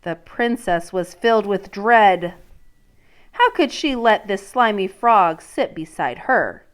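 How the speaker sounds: reading a picture book to a class; storybook read-aloud voice; story narration. Acted. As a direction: let the princess's dread come through. Teacher reads story aloud.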